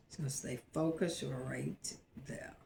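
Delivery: whispered to herself while piping; hushed under the breath, concentrating; a soft whisper as she pipes the icing. Real, not acted.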